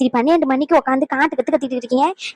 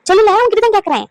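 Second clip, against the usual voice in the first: higher, sharper scolding; 6 semitones higher, 3 dB louder than usual. Acted. Grandmother scolds granddaughter-in-law, sharp demand. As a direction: demand an answer from her.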